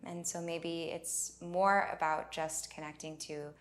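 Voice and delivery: unhurried meditation leading, leaving space for breath; guided meditation. Acted. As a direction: guide them gently inward, phrase by phrase.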